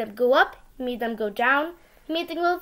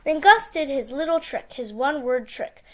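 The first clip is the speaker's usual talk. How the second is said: ordinary delivery, nothing marked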